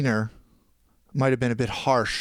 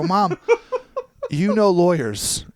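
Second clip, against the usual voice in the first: laughing; 7 semitones higher, 6 dB louder, slower than usual. Real, not acted. Laughing while quoting himself to mom.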